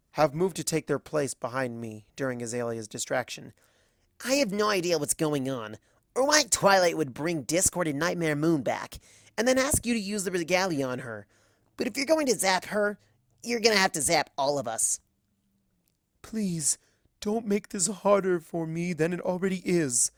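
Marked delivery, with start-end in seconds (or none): 4.2-15.0 higher, bold and defiant; a cocky hero throwing down a challenge; brash tomboy pony. Acted. Rainbow Dash to Celestia, brash defiance. 16.2-20.1 lightly higher, mild pleading; a hint of a sorrowful queen; regretful plea. Celestia to the friends, understated regret. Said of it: "Her face is full of regret".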